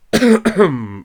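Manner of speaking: loud, pointed throat-clearing; carraspeo fingido. Pretend. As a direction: clear the throat loudly as a comic interruption. Carraspeo cómico antes de "vida. (carraspeo fuerte y teatral)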